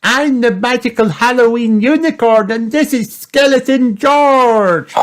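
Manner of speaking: put-on very high, loud little-girl piglet voice; squealing child show presenter; excited little Peppa. A narrator's reading. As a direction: announce it proudly, high and loud. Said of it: "Peppa cried".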